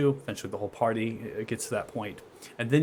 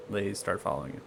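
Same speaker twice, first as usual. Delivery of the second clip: usual speaking voice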